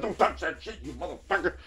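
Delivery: guarding his ukulele basket from other shoppers, mock threat; mock warning yell. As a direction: warn them off the basket, hammed up.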